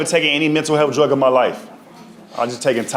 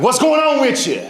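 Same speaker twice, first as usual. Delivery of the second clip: put-on high, bright old auntie doctor; high-pitched auntie asking what's up; old lady voice; 10 semitones higher than usual.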